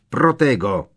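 shouted spell. A narrator's reading. Bellatrix casts shield spell.

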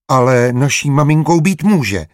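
put-on high, loud little-boy protest; insistent child. A narrator's reading. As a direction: stand your ground like a stubborn small boy. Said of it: "stál si na svém Ignác".

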